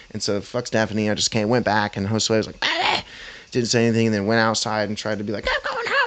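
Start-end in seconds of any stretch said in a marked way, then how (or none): 2.6-3.0 very high, short wordless squawk; imitated squawk. Coworker's reaction on his return, mock squawk. 5.5-6.0 very high, softer mocking voice; mock squeal. Coworker trying to leave after the fight, mock squeal.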